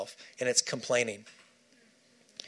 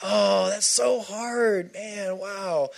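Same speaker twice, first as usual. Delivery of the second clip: slow, higher, drawn-out mock sympathy; lightly voiced friend going oh that's so hard; pitying friend; 4 semitones higher, much slower than usual. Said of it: "someone going".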